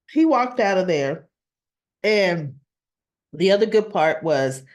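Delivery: ordinary talk, nothing marked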